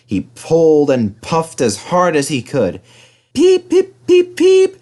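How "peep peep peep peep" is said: very high, loud whistle peeps. A narrator's reading. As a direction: toot like a cheerful whistle. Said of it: "whistled Edward".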